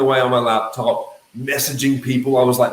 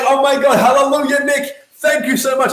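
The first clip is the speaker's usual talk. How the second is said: imagined gushing thanks; 11 semitones higher, 4 dB louder than usual. Retold or imitated. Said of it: "They're like".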